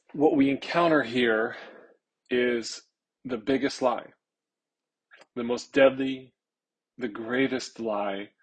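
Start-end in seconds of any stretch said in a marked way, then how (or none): none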